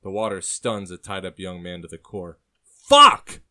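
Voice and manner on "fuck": explosive high yell; full-force cry of shock; shout. A narrator's reading. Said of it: "he shouts".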